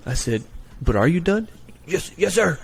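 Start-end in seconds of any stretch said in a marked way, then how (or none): 0.8-1.5 high, pointed doubting question; skeptical question. Retold or imitated. Recruiter quizzes the recruit on his trousers, pointedly skeptical. 1.9-2.6 high, hurried yes sir; recruit insisting. Retold or imitated. Recruit insists to the recruiter, high and hasty.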